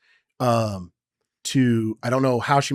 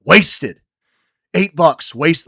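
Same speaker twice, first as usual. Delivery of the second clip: higher and louder, furious complaint; angry rant; 6 semitones higher, 11 dB louder, much slower than usual. Retold or imitated. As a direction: spit out each word in anger. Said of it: "And I was like".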